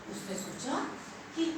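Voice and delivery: ordinary delivery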